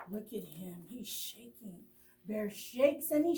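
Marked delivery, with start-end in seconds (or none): 0.1-1.8 dropped to a soft hush; hushed aside. Real, not acted. Storyteller to children, hushed aside.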